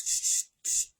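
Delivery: unvoiced shush for silence. Shown as a demonstration. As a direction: hush them with a long shh. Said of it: "it's a silence day".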